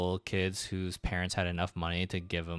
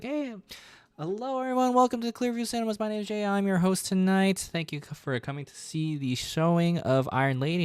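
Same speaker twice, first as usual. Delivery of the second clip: high, bright-eyed cinema host greeting; chirpy usher on the mic, much higher; overly upbeat theater announcement, pitched way up; cheery announcer voice; 12 semitones higher than usual. Retold or imitated. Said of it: "I'd be like".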